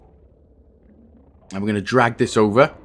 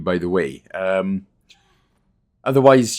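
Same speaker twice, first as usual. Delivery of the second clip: ordinary talk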